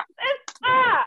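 high, drawn-out dramatic call; theatrical high-pitched reunion cry; Color Purple cry. Acted. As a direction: call out like a long-lost sister reunited. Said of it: "The color purple".

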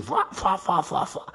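whooshing "fua" swerve noises; repeated airy skid whooshes; imitated sideways skidding. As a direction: mimic a car sliding side to side. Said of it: "se iba para los lados, así".

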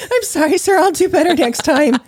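very high, whimpering mock apology; mock crying. Playing the scolded mother to the car thief, mock crying. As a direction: plead in a fake tearful squeak. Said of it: "just stand there, like, crying".

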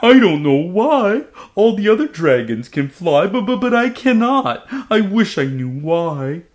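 high, loud tearful stammer; sobbing. Acted. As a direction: cry it out, stumbling on the words. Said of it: "he sobbed".

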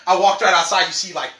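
ordinary talk

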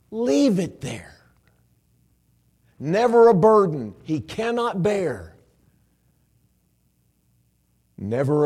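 slow, loud, drawn-out recitation; preach. Acted. Preacher reciting the songwriter's words, slow full-force preaching. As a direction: swell on each phrase like a pulpit cry.

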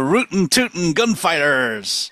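slow, hammed-up Wild West drawl; bad cowboy accent. Naming the Gunfighters episode in a slow bad cowboy accent. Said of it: "obligatory to do a really bad accent".

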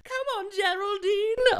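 shrill high mock mum call; mocking mother. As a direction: imitate the mother calling out. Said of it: "being like".

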